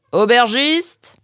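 loud, high, slow drawn-out call. A narrator's reading. The pelé calls loudly for the innkeeper. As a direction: bellow for the innkeeper. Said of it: "cria le pelé".

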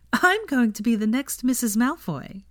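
high-pitched, grinning delight; gleeful announcement. A narrator's reading. Hermione grinning at her new fiancé, gleeful. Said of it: "grinning so broadly her cheeks".

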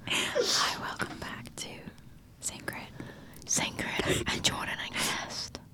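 slow whispered welcome; drawn-out ASMR host intro. Pretend. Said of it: "let's do some A S M R".

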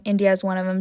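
normal talking voice

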